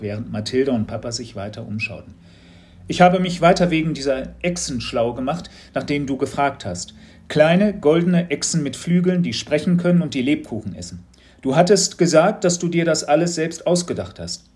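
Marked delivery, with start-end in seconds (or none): 2.9-14.4 a teacher fishing for a reaction; lightly raised curious teacher voice; knowing teacher, probing. A narrator's reading.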